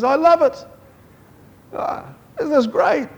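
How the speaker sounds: put-on a delighted father catching his child; God overjoyed. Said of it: "he says".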